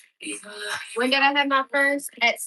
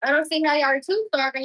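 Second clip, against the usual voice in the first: ordinary talk, nothing marked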